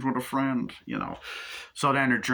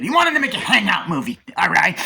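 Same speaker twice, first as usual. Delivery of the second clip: high, loud, hammed-up emphasis; emphatic exclaim; 10 semitones higher, 9 dB louder than usual. Real, not acted. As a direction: sell the point like a showman. Emphatic restatement of the point.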